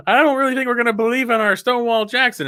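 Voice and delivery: high-pitched cartoon impression; put-on high voice, comically doubtful; Barney. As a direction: play a cartoon sidekick with pitch way up.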